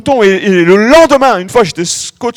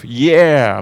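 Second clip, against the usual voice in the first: mock American yeah; 7 semitones lower than usual. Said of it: "c'est mon accent américain".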